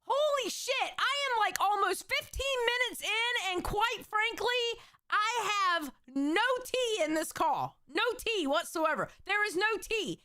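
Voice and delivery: high-pitched, wailing complaint; exasperated rant. Real, not acted. Streamer fed up with a call with no tea, exasperated rant. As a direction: rail at the wasted time, pitch way up.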